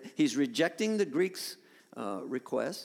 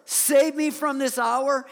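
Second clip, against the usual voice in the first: loud, raised cry to the Father; anguished plea; 7 semitones higher, 7 dB louder, slower than usual. Acted. Jesus begs the Father to save him, anguished plea. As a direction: cry out under a heavy burden. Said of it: "starting to feel the heaviness".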